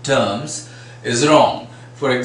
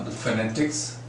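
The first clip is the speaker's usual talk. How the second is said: ordinary talk, nothing marked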